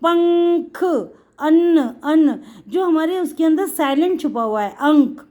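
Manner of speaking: teacher calling अंक out to the class; nasal sound demo. Shown as a demonstration. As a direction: spell out the hidden न in पंख. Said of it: "इसकी sound जा रही है ना की".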